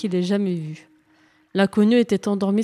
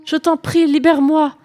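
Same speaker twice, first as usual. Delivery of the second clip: higher and louder, begging in fright; pleading; 7 semitones higher, 6 dB louder than usual. Acted. Captive sea princess begging the knight, frightened plea. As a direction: beg to be set free. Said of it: "s'écria en se débattant".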